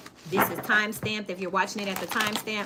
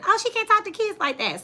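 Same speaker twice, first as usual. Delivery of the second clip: much higher, prissy scold; mock disapproving critic; 12 semitones higher, 5 dB louder than usual. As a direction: mimic the pearl-clutching critics. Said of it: "you have people saying".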